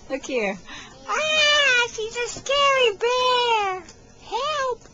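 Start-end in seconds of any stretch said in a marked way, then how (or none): 1.1-4.8 mock fright. To the toddler in bear claws, high mock-scared squeal.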